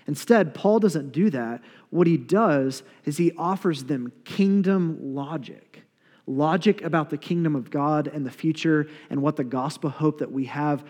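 normal talking voice

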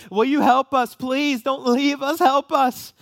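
put-on high, loud, begging cry of a small girl; little girls' desperate plea. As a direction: call out like a scared child pleading not to be left.

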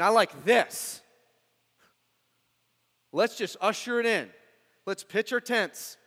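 loud, high burst of excitement; eager Peter. Acted. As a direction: plead excitedly to stay, almost shouting. Acting out Peter wanting to stay.

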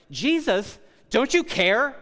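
high, loud accusing cry of Martha. Acted. Martha confronting Jesus, high and indignant. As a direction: throw the accusation up at him, pitched high.

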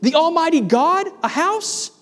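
God asking his servant in astonishment; incredulous God. Retold or imitated. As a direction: voice God's disbelief at the idea. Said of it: "It's as if God is saying".

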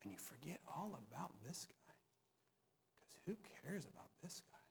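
whispered aside. Real, not acted. Pastor telling the congregation to forget him, whispered aside. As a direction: whisper it to the crowd.

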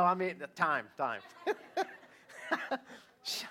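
ordinary talk

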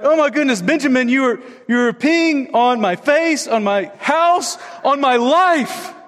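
high-pitched mock exasperation, slowing word by word; retold freak-out. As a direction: play the flustered dad, dragging out each complaint. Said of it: "like, freaking out and I'm like".

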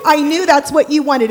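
ordinary talk

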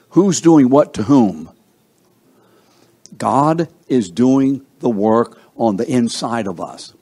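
ordinary talk, nothing marked

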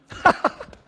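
a loud breathy laugh at the absurd timing; burst of laughter. Real, not acted. Pastor laughing at a couple who want a wedding tomorrow, loud laugh. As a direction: laugh out at the story.

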